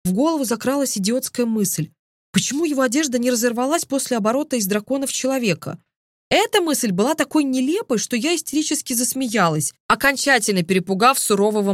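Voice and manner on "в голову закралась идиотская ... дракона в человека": ordinary delivery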